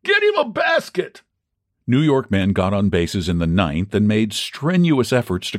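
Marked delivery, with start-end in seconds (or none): 0.0-1.2 high-pitched jeering fan's cry; heckling shout. A narrator's reading. Raging fan at the fielder who fumbled, heckling shout.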